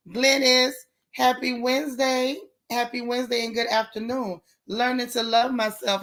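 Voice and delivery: ordinary talk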